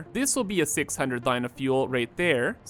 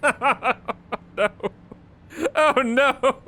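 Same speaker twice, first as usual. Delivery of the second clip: laughing despair, higher and louder; 7 semitones higher, 3 dB louder, slower than usual. Real, not acted. Builder realizing he must build six systems, laughing oh no. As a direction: chuckle through the dread of the work ahead.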